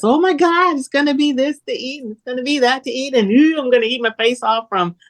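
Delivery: high, gushing mock excitement that calms down by the end; self-mocking squeal over the holiday menu, settling back to normal; giddy food-lover impression. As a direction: mimic your old self giddy about holiday food. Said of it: "I've been that girl that's".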